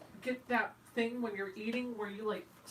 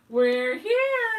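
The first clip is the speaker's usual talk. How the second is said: excited call; 6 semitones higher, 12 dB louder than usual. Retold or imitated. Friend's quote, loud and high.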